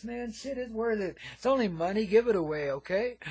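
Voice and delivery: usual speaking voice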